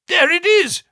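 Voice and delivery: high, loud cry of triumph; excited cry. A narrator's reading. Watson spots the signal light, crying out in exultation. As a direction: shout it out as the light appears. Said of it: "I cried".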